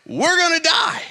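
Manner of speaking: mock panic, pitched high; high, drawn-up cry of alarm, acted out; panicked disciples. Scared disciples in a storm while Jesus sleeps, mock panic. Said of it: "They're scared".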